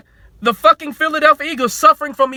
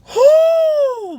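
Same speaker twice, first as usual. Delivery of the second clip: high, loud whoo of disbelief; exasperated whoo; 4 dB louder than usual. Real, not acted. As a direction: let out a long exasperated whoo. Exhales in exasperation mid rant.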